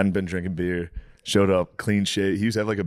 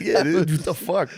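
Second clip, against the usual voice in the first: laughing disbelief; 10 semitones higher than usual. Retold or imitated. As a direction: laugh through the words. Retold reply, laughing.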